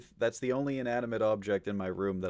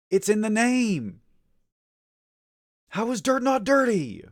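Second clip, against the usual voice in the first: exasperated shout; 9 semitones higher, 6 dB louder than usual. Real, not acted. Furious debate about dirt.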